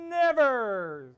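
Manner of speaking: very loud, high insistent never; defiant shout. Acted. Tina insists loudly.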